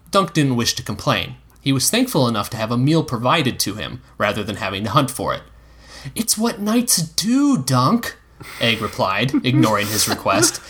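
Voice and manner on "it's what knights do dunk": put-on slow, higher cheeky boy; a knowing young squire. Egg explaining knights.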